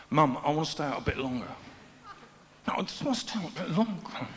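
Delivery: a hint of a pleading teen on the phone; lightly voiced teen begging mum; whiny teenager on phone. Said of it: "he picks up the phone and he dials".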